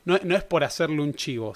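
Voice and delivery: usual speaking voice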